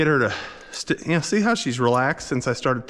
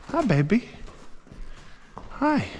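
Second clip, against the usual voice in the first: cooing; 12 semitones higher, 4 dB quieter than usual. Real, not acted. Cooing to the horse.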